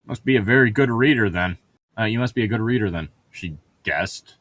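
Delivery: normal talking voice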